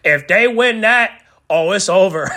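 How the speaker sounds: getting carried away with hype; hyped up. Real, not acted. Fan hyping his favourite team, excited. Hyped about tag titles win.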